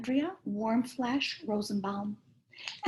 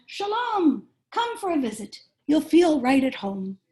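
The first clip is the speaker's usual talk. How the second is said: friendly call, pitched higher at the start; 6 semitones higher, 7 dB louder than usual. A narrator's reading. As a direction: call out a welcome to Meg. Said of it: "waved and called out".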